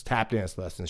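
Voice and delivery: ordinary delivery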